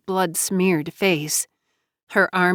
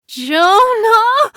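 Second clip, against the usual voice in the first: high, loud scream of terror; horrified shriek, far above usual pitch; terrified scream; 7 dB louder than usual. A narrator's reading. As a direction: scream the name out in panic, drawn long. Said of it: "JoBeth screamed in terror".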